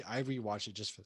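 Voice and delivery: talking through hiccups; hiccupping mid-sentence. Real, not acted. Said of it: "you're so drunk you're hiccuping".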